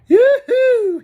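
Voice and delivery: high, loud yodeling call. A narrator's reading. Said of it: "he yodeled".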